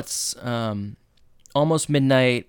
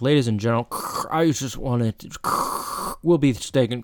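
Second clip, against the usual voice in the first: imitated broken PA with crackling static noises; garbled announcer cutting in and out with mouth-made static; loudspeaker announcement. Subway announcer to trapped riders, imitated crackly loudspeaker. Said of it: "on the loudspeaker, you hear, like".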